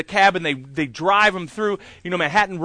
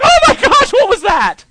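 much higher, louder startled squeal, laughing; startled yelp; 12 dB louder than usual. Retold or imitated. Actor startled by the cannon he was cued by. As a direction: jump out of your skin.

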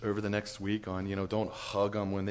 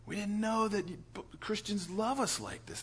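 lightly voiced woman, higher and softer; a hint of a moved wife, raised pitch and hushed; touched woman; 9 semitones higher, 8 dB quieter than usual. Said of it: "she goes".

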